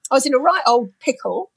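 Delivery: normal talking voice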